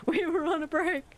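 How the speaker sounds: high, laughing we were on a break; mock sitcom quote. Streamer laughing as she quotes the sitcom again. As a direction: giggle through the famous line.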